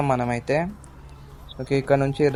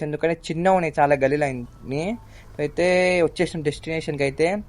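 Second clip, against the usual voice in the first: ordinary talk, nothing marked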